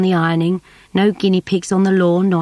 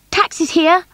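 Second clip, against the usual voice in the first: shouting; 11 semitones higher than usual. A narrator's reading. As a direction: call it across the house. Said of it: "shouted Caddy".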